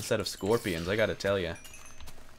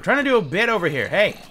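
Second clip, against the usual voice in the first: exasperated shout; 9 semitones higher, 9 dB louder than usual. Real, not acted. Mob interrupts again.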